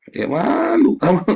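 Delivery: high, slow teasing taunt with a sob-like laugh in it; drawn-out sing-song jeer of shame; mocking shame. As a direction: shame them with a mock wail. Mocking the ignorant listener.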